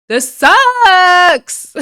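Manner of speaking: high, loud whiny cry of this sucks; venting to viewers in a loud mock wail; mock despairing wail. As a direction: wail it out like a tantrum. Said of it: "I was like".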